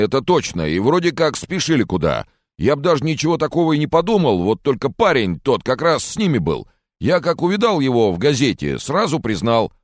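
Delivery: high, loud-edged local man's voice; rustic mechanic witness. Acted. Mechanic recounts three men to viewers, high rustic voice.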